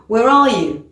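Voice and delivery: "Where are you?" with the tune dropping at the end; falling question. Shown as a demonstration. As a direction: let "you" fall, open question. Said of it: "on va baisser l'intonation".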